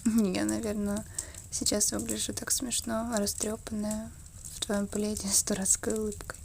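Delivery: ordinary talk, nothing marked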